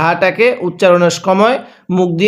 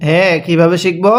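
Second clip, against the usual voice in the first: normal talking voice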